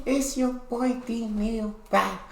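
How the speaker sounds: high, loud, drawn-out hype greeting; big high channel-intro call; hyped intro shout. Acted. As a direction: open the show like a hype man.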